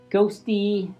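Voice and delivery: plain reading voice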